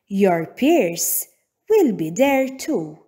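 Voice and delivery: ordinary delivery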